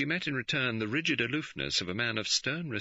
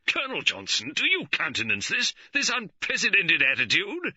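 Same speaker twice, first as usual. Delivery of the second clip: higher and louder, indignant appeal; angry protest; 12 semitones higher, 7 dB louder than usual. A narrator's reading. George appealing angrily to the Chief Constable, loud and high. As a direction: demand it furiously. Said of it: "George turned angrily".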